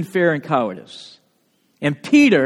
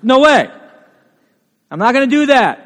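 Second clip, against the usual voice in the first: emphatic refusal; 4 semitones higher, 5 dB louder than usual. Retold or imitated. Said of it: "Very emphatic".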